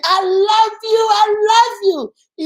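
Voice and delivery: high, slow, loving shout; sweet scream. Shown as a demonstration. Parent demonstrating a sweet loving scream to viewers. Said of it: "you're sweet and you're screaming it".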